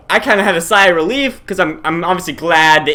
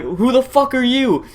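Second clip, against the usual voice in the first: higher-pitched, slow challenge; confronting demand; 7 semitones higher, slower than usual. Retold or imitated. Retelling walking in on the intruder, high confronting demand. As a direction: demand to know who's there. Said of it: "walk in there and be like".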